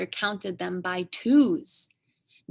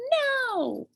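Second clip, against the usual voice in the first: very high, sharp refusal; a tiny girl yells no at the dragon; baby shout. Acted. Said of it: "shouted her second word".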